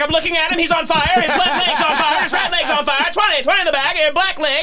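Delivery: high, rapid auctioneer patter. Retold or imitated. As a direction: rattle it off like an auctioneer. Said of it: "talking about it like a fucking auctioneer".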